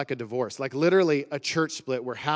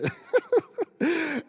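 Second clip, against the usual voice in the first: high laugh; 3 dB louder than usual. Real, not acted. Laughing at the olden days.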